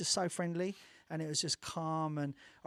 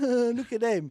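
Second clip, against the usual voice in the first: louder, snooty put-on voice; mock judgy sneer; 6 semitones higher, 9 dB louder than usual. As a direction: sneer it like a disapproving onlooker. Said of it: "no one was looking at them going".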